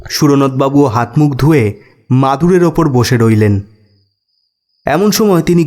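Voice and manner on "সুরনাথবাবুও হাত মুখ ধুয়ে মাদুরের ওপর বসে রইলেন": usual speaking voice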